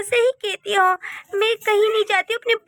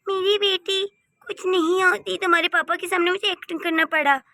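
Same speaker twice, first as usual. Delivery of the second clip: usual speaking voice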